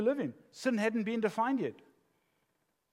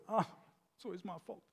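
victim voice; 8 dB quieter, faster than usual. Acted. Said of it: "The victim voice".

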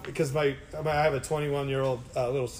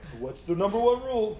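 higher, quizzing call for an answer; mock drill question; 5 semitones higher than usual. Boss quizzing his subs on the rule, drilling. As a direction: prompt them like a class. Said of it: "I even have them say it".

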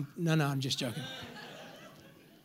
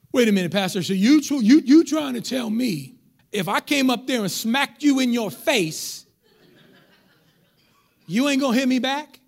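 loud, pitched-up disbelief; indignant challenge; 3 semitones higher, 6 dB louder than usual. Acted. Congregant challenging the pastor about being smacked, indignant. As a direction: act out an incredulous objector, loud and pressing.

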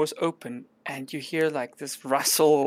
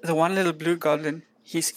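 ordinary delivery

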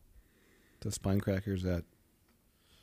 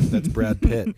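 laughing; 5 semitones higher, 7 dB louder, slower than usual. Real, not acted. Joking guess, laughing.

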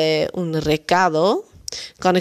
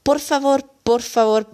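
interrupting politely; 6 semitones higher than usual. Pretend. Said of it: "onderbreken door te zeggen".

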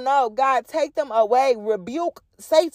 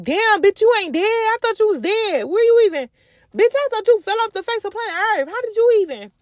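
shocked ex; 8 semitones higher than usual. Retold or imitated. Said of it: "D M y'all and be like".

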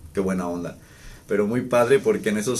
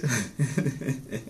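laugh; 4 semitones higher, 4 dB quieter than usual. Real, not acted. Laughs recalling girlfriend's line.

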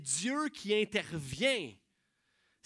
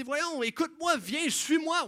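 exasperated, pushy call to follow; impatient urging; 9 semitones higher, 8 dB louder than usual. Retold or imitated. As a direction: push them along, losing patience. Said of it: "Parce que tu dis".

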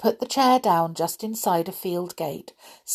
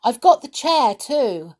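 raised voice, a bit louder; yelling back; 5 semitones higher, 5 dB louder than usual. A narrator's reading. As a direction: call across to her. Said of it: "yelled back Peter".

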